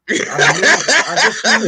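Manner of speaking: laughing through the words; real laughter breaking in. Real, not acted. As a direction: giggle it out, delighted with himself. Gloating about guessing right.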